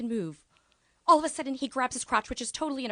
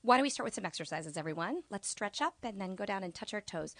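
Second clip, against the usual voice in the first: ordinary talk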